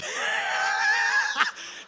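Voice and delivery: squealing burst of laughter; high shrieking laugh. Real, not acted. Big laugh.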